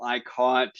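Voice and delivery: normal talking voice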